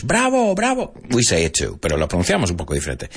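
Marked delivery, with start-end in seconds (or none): 0.0-0.9 bravo said the English way, high and stretched. Shown as a demonstration. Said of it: "We say that in English".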